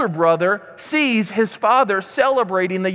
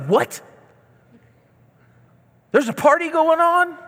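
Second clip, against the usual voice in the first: incredulous, pitched up high on the party line; surprised older brother; 8 semitones higher than usual. Retold or imitated. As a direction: act baffled that a party is going on. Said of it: "And he's like".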